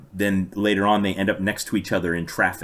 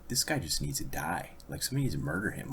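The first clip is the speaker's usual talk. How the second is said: quieter, fast muttered thought; angry inner thought; 2 semitones lower, 10 dB quieter than usual. Retold or imitated. Reading about the stepfather, a quieter fuming mutter. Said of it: "I was thinking".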